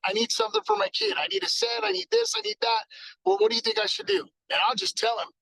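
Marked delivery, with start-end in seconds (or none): 0.1-4.2 a lightly voiced eager customer, pitched higher; a hint of a customer rattling off needs; imitating a customer. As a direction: play the shopper who needs this and that, higher. Customer voiced asking for a set.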